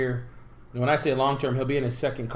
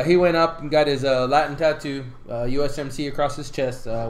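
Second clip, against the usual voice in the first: ordinary talk, nothing marked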